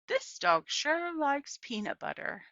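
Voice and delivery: put-on amused old southern woman. A narrator's reading. Gloria remarking to Opal about the dog, amused.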